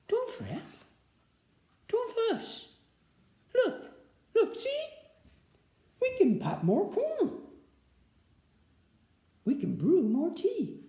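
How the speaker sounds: high, soft, quick little mouse voice; squeaky mouse. Acted. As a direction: squeak out comfort like a tiny mouse.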